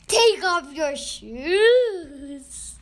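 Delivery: huge, drawn-out commanding shout; thundering. A narrator's reading. God orders Moses at the burning bush, thundering. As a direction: thunder it out slowly at full volume. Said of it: "said the voice thundering".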